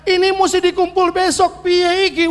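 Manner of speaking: put-on panicked child. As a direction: whine it like a worried kid. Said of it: "tiba-tiba bilang".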